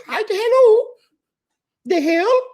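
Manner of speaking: very high, loud squeaky cartoon-mouse voice; Mickey Mouse voice. Host imitates German lady learning English, squeaky Mickey Mouse voice. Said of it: "picture her talking like Mickey Mouse".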